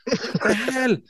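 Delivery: startled and scrambling; flustered. Retold or imitated. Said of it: "And I'm there".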